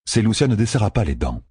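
usual speaking voice

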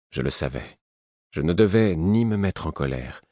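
usual speaking voice

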